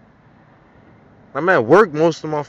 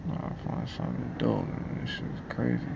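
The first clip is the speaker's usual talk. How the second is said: softer and softer, breathless whisper; whispered muttering; 4 semitones lower, 19 dB quieter than usual. Real, not acted. Alone after the rant, whispering to himself, fading out. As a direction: fade into a whisper to yourself.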